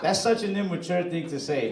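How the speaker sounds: ordinary talk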